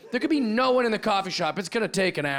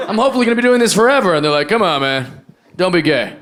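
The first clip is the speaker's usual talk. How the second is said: louder, pushed stand-up punch; loud delivery; 11 dB louder than usual. Real, not acted. Comic tells the crowd about sobriety, much louder. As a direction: belt the bit to the back row.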